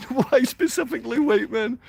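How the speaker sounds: sneering mimicry; mocking falsetto. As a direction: parrot it back in a silly high voice. Mocking the chat question.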